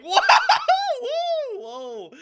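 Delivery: high, loud hooting whoa breaking into laughter; shocked whoa. Retold or imitated. Reacting to an f-bomb on broadcast, shocked. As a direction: whoop it out in astonished disbelief. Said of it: "And I'm like".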